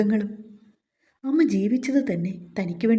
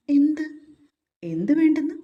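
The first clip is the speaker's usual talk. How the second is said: surprised; 7 semitones higher, 4 dB louder than usual. A narrator's reading. Husband feigns surprise, higher louder.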